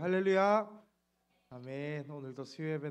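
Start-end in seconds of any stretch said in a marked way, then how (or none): 0.0-0.8 loud, higher ringing hallelujah; booming call-and-response hallelujah. Real, not acted. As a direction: shout it out once more with the people. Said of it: "우리 한 번 더 고백하실까요".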